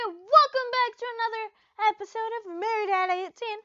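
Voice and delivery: loud, high, bright hello to viewers; big upbeat welcome; excited greeting. Real, not acted. Host greeting fans loud and excited.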